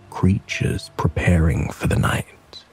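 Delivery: ordinary delivery, nothing marked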